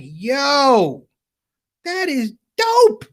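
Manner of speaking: high, drawn-out amazement; awed excitement. Retold or imitated. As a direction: act out a wowed newcomer, stretching the words high. Awestruck newcomer in the story.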